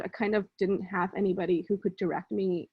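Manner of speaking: normal talking voice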